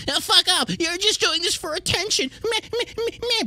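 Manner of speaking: very high, nasal-sounding mock whine; whiny mock voice. Streamer mocks commenters who say he does it for attention, very high whine. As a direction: mimic a whining commenter.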